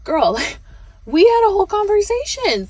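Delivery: high, loud and incredulous; exasperated disbelief. Retold or imitated. Client telling the nail tech off, high and exasperated. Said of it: "I was like".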